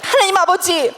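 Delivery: high-pitched cry to God, re-enacted; fervent prayer. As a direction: call on God in earnest prayer. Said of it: "기도를 하기 시작하는". (간절하게 하나님을 부르며)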